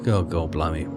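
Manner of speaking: ordinary talk, nothing marked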